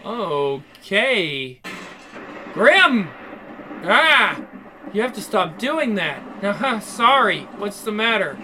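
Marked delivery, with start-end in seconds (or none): 2.5-3.1 sudden loud call of his name. Acted. Startling him in the dark. 3.8-4.4 startled yelp. Acted. Startled by sudden voice.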